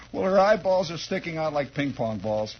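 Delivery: normal talking voice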